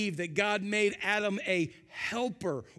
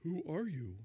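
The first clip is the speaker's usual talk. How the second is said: low, soft, deadpan "Who are you?"; flat soft question; 4 semitones lower, 9 dB quieter than usual. Shown as a demonstration. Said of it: "he probably doesn't say".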